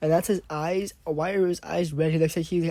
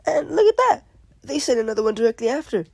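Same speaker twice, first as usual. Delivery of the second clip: excited; 8 semitones higher, 7 dB louder than usual. Real, not acted. More dolphin zombies arrive.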